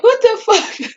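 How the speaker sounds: high, drawn-out incredulous reaction; shocked disbelief. Real, not acted. Podcaster reacting to a murder-suicide twist, high-pitched disbelief. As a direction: stretch it out high, stunned and amused.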